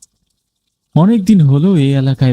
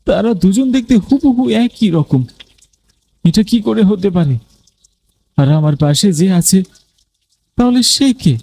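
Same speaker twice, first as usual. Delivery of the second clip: eerie astonishment; 7 semitones higher than usual. A narrator's reading. Dead man's double beside him.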